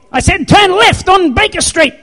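high, shouted mock scolding; mad GPS. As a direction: yell directions like a fed-up sat-nav. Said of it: "It doesn't get mad at me".

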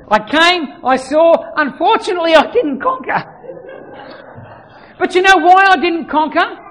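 high, loud preaching, driving the point home; full force preaching. Acted.

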